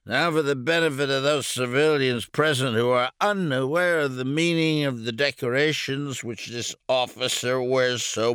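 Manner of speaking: put-on loud, high pompous drunk; drunk major. A narrator's reading. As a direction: grandiose drunken announcer. Drunk major lists decorations.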